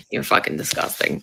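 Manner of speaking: unvoiced, laughing whisper; breathy whisper. Real, not acted. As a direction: spit it out in a hushed laugh. Laughing insult at Democrats.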